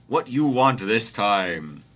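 loud, drawn-out God voice; slow, heavy divine reply; God, put upon. A narrator's reading. Host reading God answering Jesus, loud and slow. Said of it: "say God".

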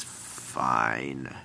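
low, drawn-out giving in; resigned character. Acted. Lucario gives up to the child, low and resigned. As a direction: give in reluctantly, voice dropped low.

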